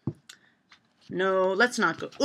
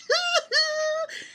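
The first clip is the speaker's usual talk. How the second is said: high giggle. Real, not acted. Laughing at pun.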